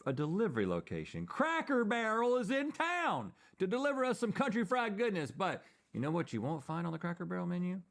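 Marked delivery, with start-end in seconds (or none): 1.2-5.3 ad read. Pretend. Ad-style intro.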